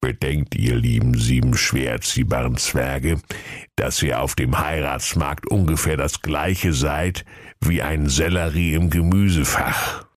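low, deliberate oracle tortoise; sage old tortoise. Acted. Oracle tortoise advises the dwarfs, old and solemn. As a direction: pronounce it like an ancient, low-voiced oracle.

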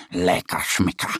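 lightly voiced happy bear. A narrator's reading. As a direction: savour it like a snacking bear cub. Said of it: "sagt er und reibt sich über den Bauch".

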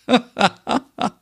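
sehr hohes, ausgelassenes Lachen. Acted. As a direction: lach laut und hoch los. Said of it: "Reika lacht". (ausgelassenes Gelächter)

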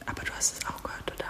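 soft, tense whisper. Acted. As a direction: keep it hushed while listening. Hiding from possible intruder.

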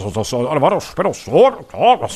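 high, fast [name] mumble; Ingo Appelt as [name]. Retold or imitated. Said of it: "war Ingo Appelt, wie er [name] parodiert".

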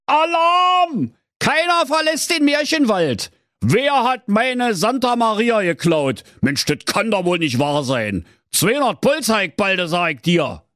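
high-pitched royal alarm; alarmed calling out. A narrator's reading. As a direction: cry out like a panicking Berlin king. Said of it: "Da rief König Pilsner".

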